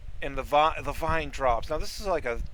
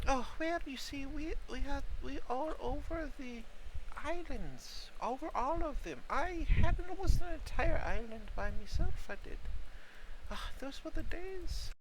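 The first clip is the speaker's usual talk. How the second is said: put-on old mushroom voice; 8 semitones higher, 3 dB quieter than usual. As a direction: elderly voice. Elderly mushroom NPC reminisces.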